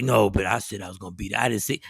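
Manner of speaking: low, soft, slurred sleepy mumble; groggy drugged voice. Retold or imitated. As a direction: sound doped up on painkillers. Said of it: "He was like".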